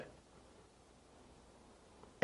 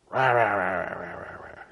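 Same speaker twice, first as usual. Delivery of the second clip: running "rah-rah" noise fading away; imitated mind noise; 6 semitones lower, 6 dB quieter than usual. Mind starts up right before sleep, fading mock chatter. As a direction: let the mock chatter tail off to almost nothing.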